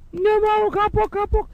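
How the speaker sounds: high, whiny imitation of a child; mock a hurt kid swearing off it; chastened child. Injured child answering scolding mother, mock wailing.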